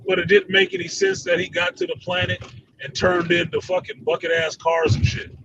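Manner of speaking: normal talking voice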